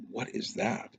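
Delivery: whispered, breathless wonder; hushed question. Retold or imitated. Brother whispers to him at the sight, hushed. Brother spots strange sphere.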